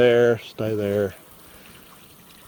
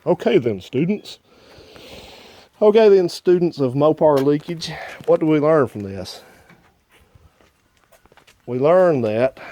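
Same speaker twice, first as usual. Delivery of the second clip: higher, louder classroom lecturer; mock professor voice; 6 semitones higher, 5 dB louder than usual. A man sums up the leak test to imaginary students, mock professor voice. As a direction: address the class like a professor, pitched up. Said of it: "In my best professor's voice".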